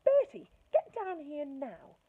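a mum summoning her son; angry mum shouting. Acted. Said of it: "a shout from downstairs".

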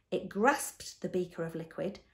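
ordinary delivery, nothing marked

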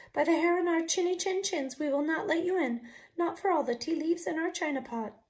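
high, loud, defiant chant; little wolves' voice. Acted. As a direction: stand firm in tiny high voices. Wolves refusing the pig.

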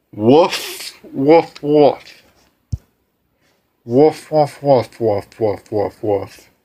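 hammed-up barking woofs that fade away; cringy dog voice. Dog greeting the newcomer, goofy barking woofs. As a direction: bark it out like a goofy dog, then trail off. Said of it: "I'll do some cringy voices".

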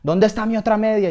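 high, loud angry interrogation; threatening demand. Acted. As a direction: demand it like a furious gang boss. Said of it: "me amenazaba y me decía".

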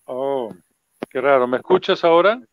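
usual speaking voice

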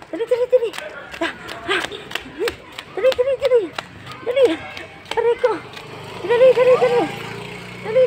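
high urgent calls for the dog to hurry; high coaxing call. Real, not acted. Owner calling dog Mingay to run, high and urgent. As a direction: chivvy the dog along, pitched high.

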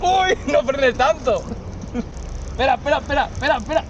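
high, fast begging to stop; panicked pleading. Real, not acted. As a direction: beg the driver to stop braking. Water sloshing, begs driver stop.

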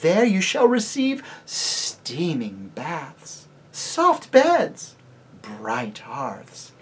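high, loud, slow and sweet lady's voice; lady tempting the children toward Harfang; enchanting lady. Acted. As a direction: lure them with honeyed warmth. Lady character voice.

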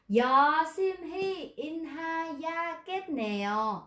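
teacher shows how Yoshimi inhayagetneo sounds when read word by word, wooden; exaggerated robotic reading, drawn out; stiff tiring reading. Shown as a demonstration. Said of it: "Đọc rất là mệt".

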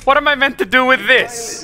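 high-pitched frustrated demand; exasperated outburst. Real, not acted. Useless loot while under attack.

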